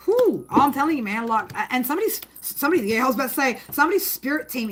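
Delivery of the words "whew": high whew. Real, not acted. Reacting to intense energy.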